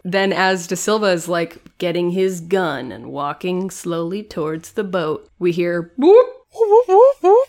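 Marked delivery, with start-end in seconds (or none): 6.0-7.4 high loud siren beeps; siren noise. Retold or imitated. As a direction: beep out like a police siren. Imitating a siren sound.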